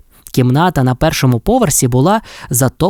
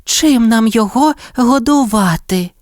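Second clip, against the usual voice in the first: much higher pigeon voice; fussy pigeons; pigeons' voice; 9 semitones higher than usual. A narrator's reading. Pigeons ask how to feed chick, high fussy voice. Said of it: "запитали вони".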